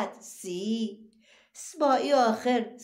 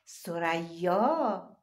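slow syllable reading; 3 semitones lower, much slower than usual. Shown as a demonstration. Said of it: "باز تشدید داره".

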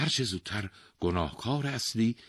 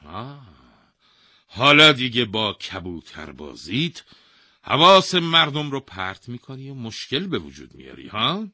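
loud, high scolding; angry king blaming the pigeon keeper; 6 semitones higher, 11 dB louder than usual. Acted. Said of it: "با عصبانیت گفت".

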